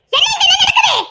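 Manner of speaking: bursting in, demanding to know; mother storming in, shocked. Acted. Mother demands explanation again.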